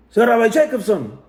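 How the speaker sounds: high, loud eager call across the room; excited greeting. Retold or imitated. Rabbi spotting Rabbi Jacobson at shul, excited call. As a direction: hail him like a long-lost friend. Rabbi calls out on seeing him.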